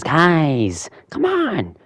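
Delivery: high, drawn-out mock plea; pleading call. Joker calling to his gang, high acted plea.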